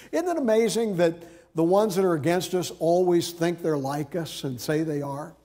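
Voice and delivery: ordinary delivery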